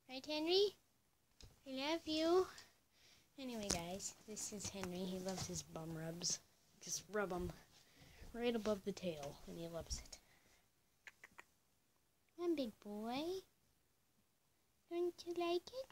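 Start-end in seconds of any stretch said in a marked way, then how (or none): 0.1-2.5 pet talk. Real, not acted. Calling pet Henry over, high loud cooing. 12.4-15.9 pet talk. Real, not acted. Cooing at pet Henry.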